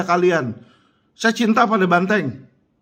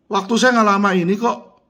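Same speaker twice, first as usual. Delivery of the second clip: usual speaking voice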